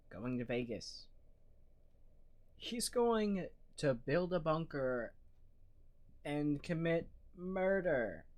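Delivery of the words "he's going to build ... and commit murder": slow, raised-pitch disbelief; incredulous emphasis. Real, not acted. Streamer reacting to a man's midlife crisis plan, incredulous. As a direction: spell out the absurdity, drawn out and higher. Incredulous punchline after listing ordinary crises.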